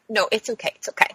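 very soft reassurance; soothing attendant. Retold or imitated. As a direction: hush a crying patient gently. Attendant soothing the crying patient.

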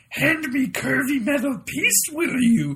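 Holly answering Michael in Yoda. Retold or imitated. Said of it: "she answers him back in Yoda".

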